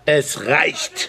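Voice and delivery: angry shout. Acted. Shouting stop.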